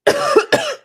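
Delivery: loud sharp cough; coughing fit. Real, not acted. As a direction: cough hard, still choking.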